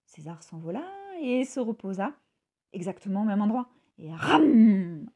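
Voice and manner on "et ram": very loud snapping chomp; loud jaw snap. A narrator's reading. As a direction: bite down with a loud ram. Crocodile snaps again.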